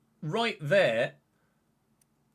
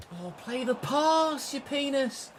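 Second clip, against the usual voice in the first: high, slow and whiny; exasperated plea; 11 semitones higher, slower than usual. Real, not acted. Gamer pleading with his teammate to pass, exasperated whine. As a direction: drag it out, begging in frustration.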